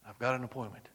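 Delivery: soft, unbothered reply; calm quiet answer. Acted. Jesus calmly answers the worried disciples, soft and sure. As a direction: settle it gently and simply.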